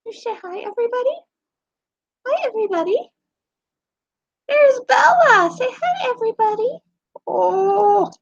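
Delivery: high, sweet pet-talk voice. Real, not acted. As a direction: coo at the dog. Baby talk to dog.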